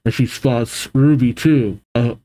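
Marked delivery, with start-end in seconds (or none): none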